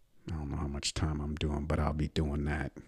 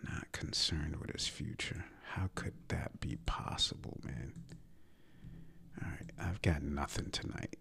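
whisper; 8 dB quieter than usual. Real, not acted. Mocking coach's calm.

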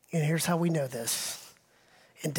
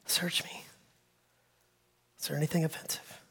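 hushed prayer; 2 semitones lower, 8 dB quieter, faster than usual. Pretend. Quoting own prayer.

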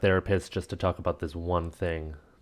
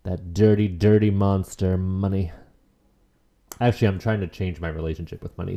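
ordinary talk

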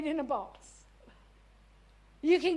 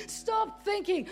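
normal talking voice

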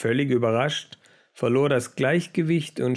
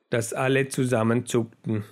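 usual speaking voice

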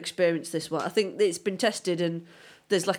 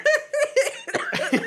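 high burst of laughter; 4 dB louder than usual. Real, not acted.

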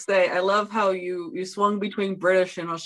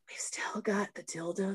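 whisper; 12 dB quieter than usual. Acted. Whispered aside in reading.

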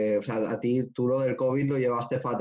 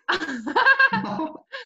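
high, loud burst of real laughter; delighted laugh after good news; big laugh; 5 dB louder than usual. Real, not acted.

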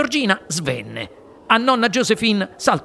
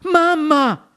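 higher, louder call; exclaiming; 9 semitones higher, 4 dB louder than usual. Acted. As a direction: run to mother. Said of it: "esclamò Charlie". (esclamazione alta)

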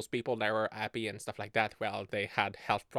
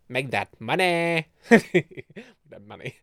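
mock hustle; 4 semitones higher, 10 dB louder, slower than usual. Hyping making money.

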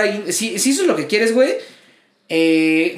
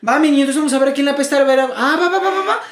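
higher, fast, rowdy street taunting; mock aggressive woman; 6 semitones higher than usual. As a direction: taunt like the angry pizza customer, higher and rushed. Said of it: "la morra se pone a hablar como".

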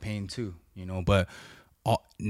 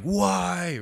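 high, pleading why, thrown upward; a frustrated child asking heaven; mock plea, pitched high; complaining to God; 9 semitones higher than usual. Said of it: "and I'm like".

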